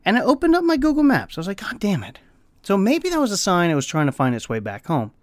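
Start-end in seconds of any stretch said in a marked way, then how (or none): 1.6-2.2 soft resigned God damn it; muttered curse. Retold or imitated. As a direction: mutter it under your breath. Bird opened Google Maps.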